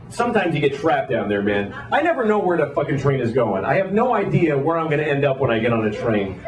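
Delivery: ordinary talk, nothing marked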